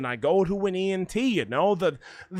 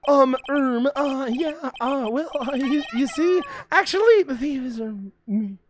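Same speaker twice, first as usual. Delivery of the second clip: higher, hesitant stammering; nervous stammer; 6 semitones higher than usual. Acted. Ron admitting the thieves are him, higher and fumbling. Nervous game character confessing.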